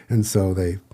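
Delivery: ordinary talk, nothing marked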